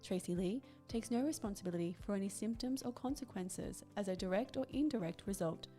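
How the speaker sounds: disclaimer read. Acted. Podcast host reading the legal disclaimer to listeners, formal read. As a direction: read out the small print, quiet and formal.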